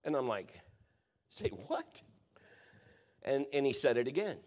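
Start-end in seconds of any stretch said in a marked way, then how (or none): 1.3-2.0 high squeaky disbelief; stunned high-pitched say what; incredulous squeak. Retold or imitated. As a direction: squeak it out in shock. Incredulous reply to God's command.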